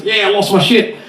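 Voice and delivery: lightly put-on Australian bloke; Australian accent. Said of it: "when I was over in Australia".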